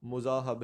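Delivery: مذاہب as "mu-zaa-hab", u first; pronunciation option. Shown as a demonstration. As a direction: say مذاہب starting "mu", ending "hab". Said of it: "درست لفظ کو کیا ہوگا".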